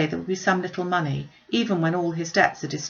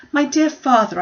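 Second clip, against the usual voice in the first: excited; 9 semitones higher, 7 dB louder than usual. Acted. Sister congratulating father.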